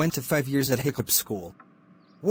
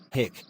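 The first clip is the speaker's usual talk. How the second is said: hiccup; 2 semitones lower, 4 dB quieter than usual. Acted. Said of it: "I think you have the hiccups".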